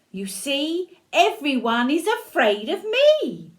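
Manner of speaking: high, loud, proud lilt; playful storybook bragging; boastful mouse. A narrator's reading. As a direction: crow it as a triumphant little mouse.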